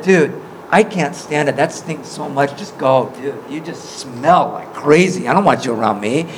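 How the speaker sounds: low-pitched, put-on brush-off; mock disgusted rejection. God imagined turning Moses away, mock disgusted.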